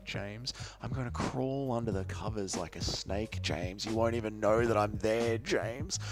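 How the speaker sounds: menacing creep teasing a friend; creepy stalker voice. Pretend. Creepy stalker bit to James.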